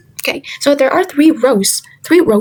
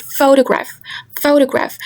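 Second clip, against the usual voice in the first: photograph with pho stressed and higher; 4 semitones higher than usual. Shown as a demonstration. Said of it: "more emphasis in the word pho".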